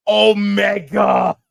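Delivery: slow, stretched, high shout of the name; hyped shout. Real, not acted. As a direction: call out the name like a ring announcer. Hyping a wrestler's entrance.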